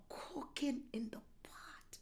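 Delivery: soft whisper, keeping a secret; whispered tease. Acted. Pastor whispering to viewers that something is cooking. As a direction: hush it like a secret.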